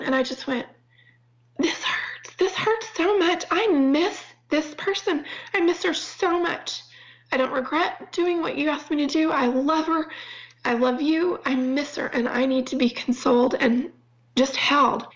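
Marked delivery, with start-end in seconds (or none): 1.6-2.3 barely whispered, holding back tears; choked whisper. Real, not acted. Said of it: "Okay, I'm gonna try not to cry". 2.4-15.1 higher, near tears; tearful prayer. Real, not acted. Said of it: "Okay, I'm gonna try not to cry".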